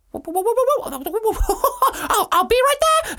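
high, loud whooping monkey. Acted. Monkey voiced in story.

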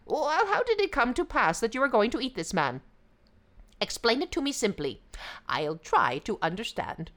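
high, fast jackal voice; coaxing, feigned innocence; sly yippy jackal. Acted. Jackal questions the tiger.

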